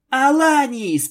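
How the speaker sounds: high, loud, drawn-out shout. A narrator's reading. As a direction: call the name out in alarm. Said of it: "прокричала Твайлайт". (громкий протяжный крик)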